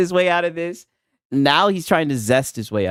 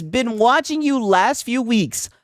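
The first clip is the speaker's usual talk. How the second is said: slow, higher chat reading; 6 semitones higher, much slower than usual. Real, not acted. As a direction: read the chat slowly for effect. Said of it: "reads chat message aloud".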